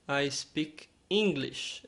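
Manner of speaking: slow, clear English line; slow model sentence. Shown as a demonstration. Teacher giving a line for learners to repeat. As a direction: say it slowly so they can repeat. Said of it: "É muito bom você repetir".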